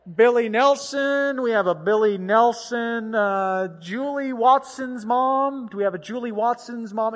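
loud, drawn-out names called over a crowd; slow public-address call; mock announcer calling. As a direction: call out lost children like bingo. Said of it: "like, toddler bingo on the mic".

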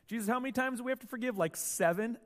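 quick, eager, showing off; eager Peter. Acted. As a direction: rush it out like a proud student. Peter voiced, eager to impress.

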